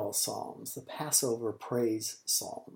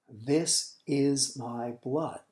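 solemn slow; much slower than usual. Acted. Jesus words, very slow.

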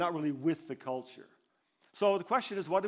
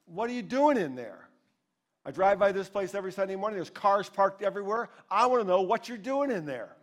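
higher, pressing mock challenge; hammed-up lightly, a bit louder; retold upset demand; 5 semitones higher, 9 dB louder than usual. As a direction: imitate a suspicious older man, mildly. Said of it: "it sounded like he might be upset".

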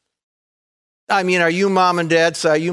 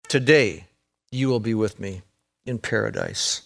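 softer, fading tender promise; hushed promise; 6 semitones lower, 5 dB quieter than usual. Retold or imitated. Preacher quoting Jesus to the dying criminal, hushed and tender. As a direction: give it gently, barely above a breath.

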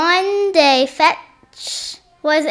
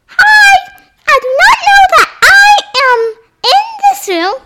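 much higher, loud and fast toy voice; squeaky Rocky voice; 6 dB louder than usual. Pretend. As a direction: pipe up as a silly toy friend.

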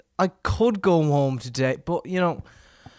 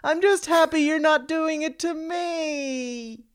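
much higher, slow, faux-shocked simper; mock horrified passenger; 11 semitones higher, slower than usual. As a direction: play a smug bystander pretending to care. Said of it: "they'll rather just say".

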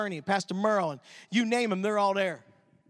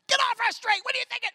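mock angry yell; 12 semitones higher, 4 dB louder than usual. Retelling crowd cursing him.

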